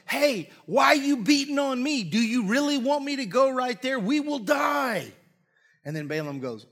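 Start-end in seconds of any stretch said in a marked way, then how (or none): 0.1-5.1 high-pitched, hammed-up protest; indignant talking donkey. Beaten donkey turns and protests to Balaam, hammed-up indignation. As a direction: play an alarmed donkey, stretching the last words out.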